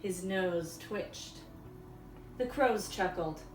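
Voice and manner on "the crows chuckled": usual speaking voice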